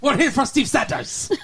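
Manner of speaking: hammed-up Mexican gang voice; Mexican accent. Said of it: "I don't know why they have that accent".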